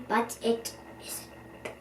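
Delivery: slow secretive whisper. A narrator's reading. Reader whispering a surprise twist to listeners.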